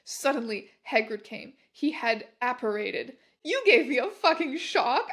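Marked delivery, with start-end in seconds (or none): 3.4-5.1 higher and louder, startled fury; angry shout. Acted. Heroine yelling at Hagrid in the girls' room, angrily. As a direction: yell it like you've been startled. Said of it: "I shouted angrily".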